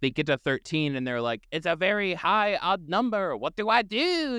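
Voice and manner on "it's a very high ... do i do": high, panicky imitation; hammed-up rising distress; mock freak-out. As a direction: play a creature in comic panic. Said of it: "they freak out".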